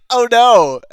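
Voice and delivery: high, loud mock cry of oh no; hammed-up scared little girl. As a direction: squeal it like a scared kid. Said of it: "Leia's like".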